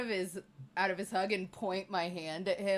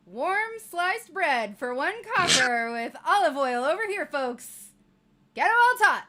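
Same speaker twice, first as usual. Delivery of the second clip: loud, high street-vendor call; market hawker shout; 7 semitones higher, 11 dB louder than usual. Pretend. Girl selling bread to passing folks, loud hawker call. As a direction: cry out your wares to the crowd.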